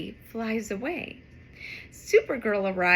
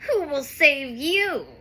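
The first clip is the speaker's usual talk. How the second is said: angry dog; 5 dB louder than usual. Acted. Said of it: "says the angry dog".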